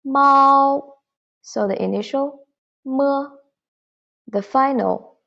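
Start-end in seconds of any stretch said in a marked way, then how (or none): 0.1-0.9 mao sung out in the first tone, high and level; pinyin syllable mao. Shown as a demonstration. Teacher models mao for students, high level tone. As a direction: say mao clearly, holding it high and flat. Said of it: "this syllable, cat".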